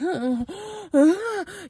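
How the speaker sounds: high, loud mock wail; imitated crying. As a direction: act out helpless heaving sobs. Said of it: "I'm, like, crying".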